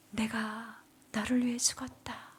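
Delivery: whispered, solemn divine voice; soft reverent whisper of Jesus. Retold or imitated. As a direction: hush it like a holy voice. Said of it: "음성이 들렸습니다".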